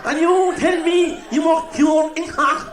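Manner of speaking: high, loud and slow; incredulous shout. Acted. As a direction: bellow it in disbelief, laughing.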